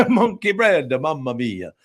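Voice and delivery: slow mock-Italian through laughter; Italian accent. Echoing co-host's toddler joke, laughing Italian accent. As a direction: echo the Italian caricature while laughing. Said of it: "have an Italian accent".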